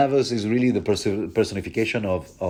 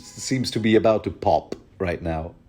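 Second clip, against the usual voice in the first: ordinary talk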